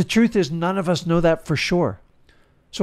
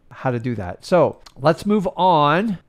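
ordinary delivery

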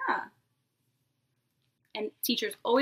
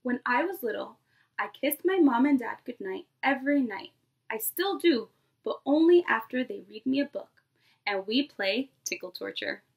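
lively picture-book read-aloud to a class; storybook voice for a nursery class; reading to children. Acted. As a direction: read it like story time for little kids. Teacher reads picture book aloud.

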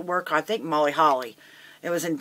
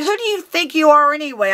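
loud, high-pitched yelling; indignant, explosive shouting; furious outburst; 11 semitones higher, 8 dB louder than usual. Retold or imitated. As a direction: tear into him at the top of your voice. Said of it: "I just blew up".

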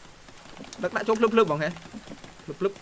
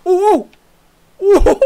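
much higher, much louder startled cry; startled shout; 10 semitones higher, 12 dB louder than usual. Real, not acted. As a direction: yelp at the sudden hit. Sudden shock in the game. (ស្រែកភ្ញាក់ផ្អើលខ្លាំង)

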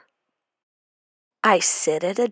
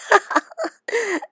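evil laugh; 14 semitones higher than usual. Acted. Monster eats the boy.

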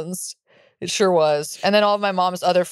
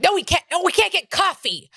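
high-pitched mock scolding; exasperated mom; 10 semitones higher than usual. Mom refusing coffee before the funeral, mock exasperated shriek. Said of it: "And my mom's like".

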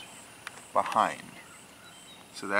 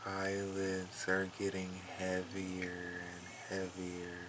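slow, hushed hypnotist drone; playfully hypnotize the cat into sleep; mock hypnosis; 3 semitones lower, 11 dB quieter, slower than usual. Hypnotizing her cat Mookie, slow soft mock hypnotist. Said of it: "giving a hypnosis session to Mookie".

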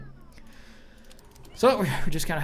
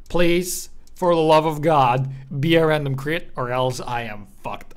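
pleading prayer; 8 dB louder, slower than usual. Retold or imitated. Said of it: "you're like".

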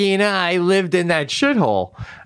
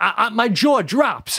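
ordinary delivery

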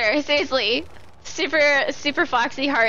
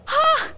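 sharp gasp. Real, not acted. Reaction to goal progress.

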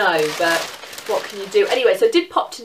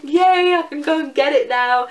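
high, loud happy yay; excited squeal; 6 semitones higher, 5 dB louder than usual. Retold or imitated. Retelling her joy at getting the dress, a high loud squeal. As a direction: squeal with delight at the bargain. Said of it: "I was like".

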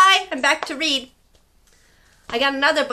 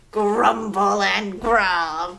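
lower, slow growly voice; playful dino growl; mock growly T-Rex; 4 semitones lower, much slower than usual. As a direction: grumble it like a dinosaur. Playing T-Rex with kids.

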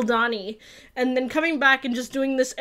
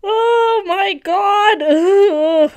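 slow, tearful and louder, words breaking; choking up through a laugh; real tears over a TV scene; crying; 6 semitones higher, 8 dB louder, much slower than usual. Real, not acted. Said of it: "Why am I crying for that?".

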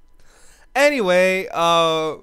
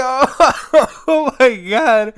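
loud laughing oh my god, drawn out; laughing shock; 6 semitones higher, 7 dB louder, much slower than usual. Retold or imitated. Reacting to the mess in the shower, loud laughing. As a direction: laugh out loud in horrified disbelief. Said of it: "I was laughing".

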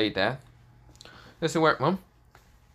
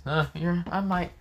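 higher, drawn-out mock concession; lightly amused, pitched-up tease; teasing, chuckling manner; 10 semitones higher than usual. Issei needles an angry rival, mildly amused and teasing. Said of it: "Issei chuckles and says".

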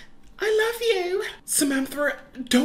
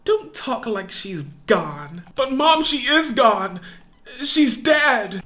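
ordinary talk, nothing marked

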